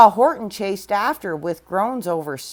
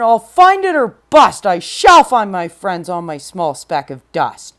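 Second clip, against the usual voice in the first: determined cry; 3 semitones lower, 8 dB louder than usual. Acted. Horton vowing to find his friends, loud determined cry. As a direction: cry out a stubborn promise. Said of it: "cried Horton".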